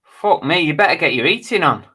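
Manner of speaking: loud, high exclamation of disbelief; loud mock-horror at a mild temperature; shocked outburst. Real, not acted. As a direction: blurt it out, amazed at the number. Reacts to Alexa saying fifteen degrees.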